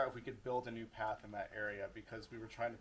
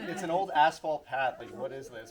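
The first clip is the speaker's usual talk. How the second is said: excited disbelief; 2 semitones higher, 12 dB louder than usual. Retold or imitated. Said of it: "And we're like".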